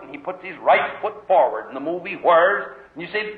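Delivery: ordinary talk, nothing marked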